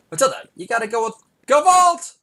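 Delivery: high, loud insistent yell; pushy shout. Retold or imitated. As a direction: push the word on her like a pushy uncle. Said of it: "felt compelled to push the issue and say".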